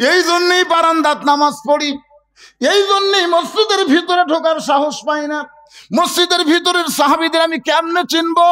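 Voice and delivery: high, keening complaint; anguished outcry. Acted. Shepherd lamenting the traveller who ruined him, anguished outcry. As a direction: cry it out in distress, pitch held high.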